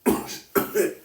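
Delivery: harsh real cough; sudden hacking cough; coughing fit. Real, not acted. Sorry, bad cough.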